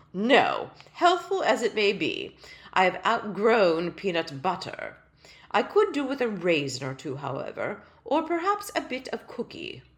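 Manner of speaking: loud, stately royal voice; a small king refusing with dignity; pompous tiny king. Acted. Tiny king turning down peanut butter, loud and pompous. King's voice held.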